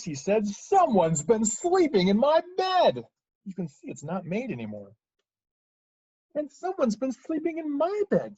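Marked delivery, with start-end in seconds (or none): 0.5-3.1 loud, slow, high bellow; huge outraged Papa Bear voice; great huge Papa Bear. Acted. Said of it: "and in his great, huge voice". 6.3-8.4 high-pitched mother bear, moderate volume; put-out, high Mama Bear; medium-sized Mama Bear. Acted. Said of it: "in her medium-sized voice".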